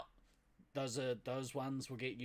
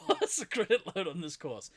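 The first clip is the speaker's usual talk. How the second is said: laughing; 5 semitones higher, 6 dB louder than usual. Real, not acted. Joking about a course.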